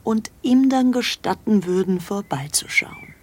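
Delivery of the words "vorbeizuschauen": slow whispered word; confiding whisper about the secret visit. Real, not acted. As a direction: let it fall to a whisper. Secret request relayed.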